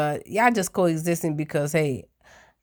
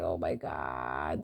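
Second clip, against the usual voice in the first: low exasperated voice; 13 dB quieter, slower than usual. Retold or imitated. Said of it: "I'm like".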